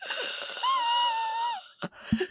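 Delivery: long high rasping inhale like Nosferatu; creepy vampire wheeze. Shown as a demonstration. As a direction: wheeze in like a ghoul. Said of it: "I'm really good at the wheezing that Nosferatu does".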